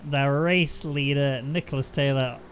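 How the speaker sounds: slow, building play-by-play call; race commentary. Acted. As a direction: call the final corner like a commentator.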